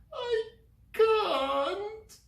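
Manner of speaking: very high tearful wail. Acted. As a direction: wail in despair. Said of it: "wailed Sylvie".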